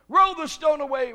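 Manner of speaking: high, loud order; commanding shout. Acted. Jesus orders the stone rolled away, loud command. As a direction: ring it out as a command.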